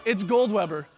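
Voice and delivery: usual speaking voice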